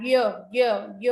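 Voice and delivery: "ย" as a short, separate "yuh"; phonics Y sound. Shown as a demonstration. Teacher sounding out Y for children, short "yuh" for "ย". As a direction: sound out "ย" as yuh, one beat each. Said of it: "ยอยักษ์นะคะ".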